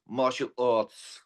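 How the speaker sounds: put-on "Martial arts" said slow in a London accent, no r sounds, mɑʃəl ɔts. Imitating how Chris says "Martial arts" in London accent. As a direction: drawl "Martial arts" like a South Londoner, r's dropped. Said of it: "the way he pronounces martial arts".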